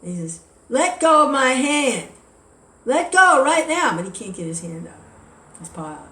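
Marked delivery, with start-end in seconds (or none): 0.7-3.9 slow, much higher, loud demand; furious shout. Retold or imitated. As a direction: bellow it, stuck and furious. Rabbit stuck, demands release.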